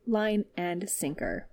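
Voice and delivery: ordinary delivery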